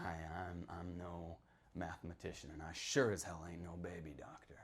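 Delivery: whispered under his breath; muttered aside. Acted. Old townsman whispering his aside to the listener, hushed. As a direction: drop to a breathless whisper, like a confession.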